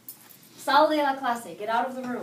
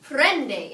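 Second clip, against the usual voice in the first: "Prende" said loud as PREN-de; stress on second-to-last syllable; 5 dB louder than usual. Shown as a demonstration. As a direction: confirm "Prende" with the weight on PREN. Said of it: "It's over the second to last syllable".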